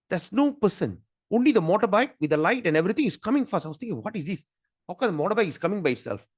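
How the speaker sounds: rising up in a rush of astonishment; excited retelling. Real, not acted.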